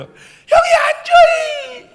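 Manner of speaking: shrieking high mock sobs, drawn out; hammed-up tattletale wail; crying little brother. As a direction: bawl the complaint like a small child. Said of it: "이렇게 옵니다".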